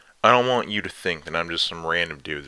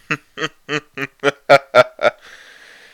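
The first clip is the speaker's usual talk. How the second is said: loud gloating laugh; 11 semitones higher, 13 dB louder than usual. Acted. Dominant lover gloating.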